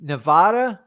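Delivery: Nevada with an open AH, nuh-VAH-duh, as a question; questioned pronunciation. Shown as a demonstration. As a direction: ask back Nevada, VAD rhyming with "pod". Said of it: "say it again".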